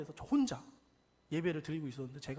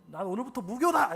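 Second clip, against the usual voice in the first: retold angry declaration; 4 semitones higher, 8 dB louder than usual. Retold rant at God.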